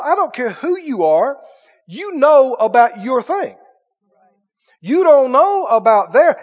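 usual speaking voice

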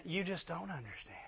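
hushed, near-whispered self-pity; mock pitiful. Preacher mimicking people who want sympathy, mock pitiful. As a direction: drop to a soft, feeble complaint. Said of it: "you gotta look pretty pitiful".

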